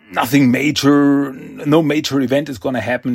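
ordinary delivery

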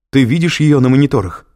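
loud, high frantic question; alarmed shout down the phone. A narrator's reading. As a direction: demand an answer urgently. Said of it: "закричала Кэтрин".

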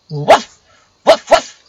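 loud imitated dog barks; angry barking. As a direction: bark hard in protest.

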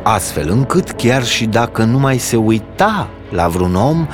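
usual speaking voice

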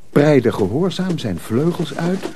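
ordinary talk

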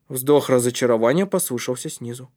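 plain reading voice

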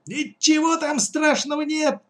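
impatient high-pitched exclamation; high-pitched cry. Acted. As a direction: call it out high, without extra volume. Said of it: "закричала мать".

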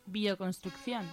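ordinary talk, nothing marked

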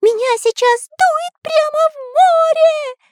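very high cartoon panicked shout. The artist blown toward the sea, high panicked shout. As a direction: scream it way up over the storm. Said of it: "кричал Карандаш".

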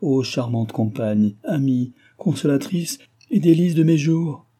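slow start building into ardent exclamation; beside himself. A narrator's reading. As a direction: read it as a swelling declaration of adoration. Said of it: "ajouta Delville hors de lui-même".

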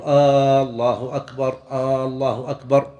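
long "aa" opening each "الله أكبر", like "aaallahu"; slow muezzin error, first vowel of "الله" drawn out; wrong stretched opening vowel. Retold or imitated. Teacher repeating a muezzin's error, "الله" opened with long aa. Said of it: "فهذا خطأ ولا يصح الأذان".

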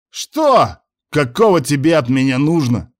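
put-on swaying drunk man yelling at his brother; shouting. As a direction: roar it back at the brother. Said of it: "заорал Муса на брата".

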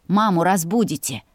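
ordinary delivery, nothing marked

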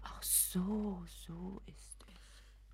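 slow breathless whisper; wife realising the truth, in a slow whisper; whispered realisation. Acted. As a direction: let it sink in, barely voiced. Dawning realisation.

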